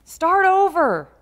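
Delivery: high, drawn-out preacher's shout; full-voiced imitation of a yelled command; loud yell. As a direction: yell it out like a prophet calling to crowds. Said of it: "as he yelled in a loud voice".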